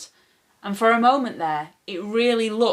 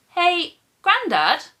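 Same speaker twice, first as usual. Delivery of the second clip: higher, louder, slow call across the garden; 10 semitones higher, 5 dB louder, slower than usual. A narrator's reading. Boy calling Grandad across the garden, loud and high. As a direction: call out to him from a distance. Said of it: "I called".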